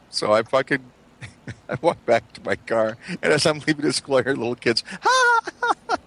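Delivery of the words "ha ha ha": high mocking ha ha ha; sing-song schoolyard jeering laugh; mock kid laughter. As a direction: cackle like taunting little kids. Kids laughing at him, mimicked.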